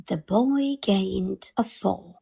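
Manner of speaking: ordinary delivery, nothing marked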